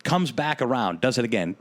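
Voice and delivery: ordinary delivery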